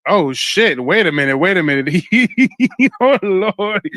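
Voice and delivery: loud, high, startled outburst; astonished laughing. Real, not acted. Host caught off guard by the accent slip, astonished laughing. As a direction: burst out in shock, breaking into laughter.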